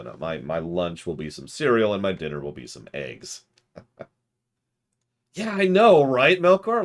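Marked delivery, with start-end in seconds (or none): 5.3-6.8 excited. Real, not acted. Excited reply to chat.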